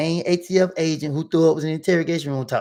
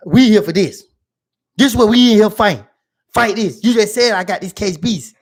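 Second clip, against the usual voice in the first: loud, higher-pitched retort; heated argument; 5 semitones higher, 9 dB louder than usual. Retold or imitated. Client arguing with his lawyer over the case, heated. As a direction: argue back loudly, punching the words.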